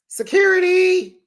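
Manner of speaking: high-pitched mock yell for help; mock cry for security. Mimics Heavenly calling for security.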